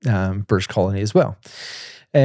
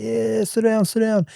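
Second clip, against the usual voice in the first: much higher, eager mock voice of the street guys; homeless guys welcoming; 11 semitones higher, slower than usual. Homeless men inviting the narrator to sit, in a high mock voice. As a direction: pitch it way up, casual and keen.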